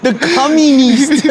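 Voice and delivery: high giggling while talking; laughing. Real, not acted. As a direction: laugh the words out. Laughing through words.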